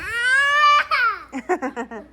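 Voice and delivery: squealing high giggle; high laugh. Real, not acted. Mother giggling high at her toddler. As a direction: break into a high delighted giggle.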